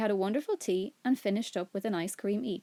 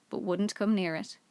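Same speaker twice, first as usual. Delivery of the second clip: ordinary delivery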